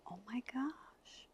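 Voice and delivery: awed whisper. Retold or imitated. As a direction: whisper it as the truth dawns. Realizing the premonition came true.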